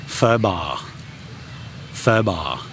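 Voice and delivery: pho ba with "ba" sliding down low; falling tone. Shown as a demonstration. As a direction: model pho ba, dropping on "ba". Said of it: "it goes down".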